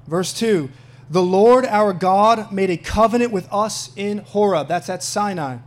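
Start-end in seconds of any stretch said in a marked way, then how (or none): 1.1-4.7 loud, slow proclamation of scripture; deliberate public Bible reading. Acted. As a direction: proclaim the verse to the congregation. Reads scripture aloud to congregation.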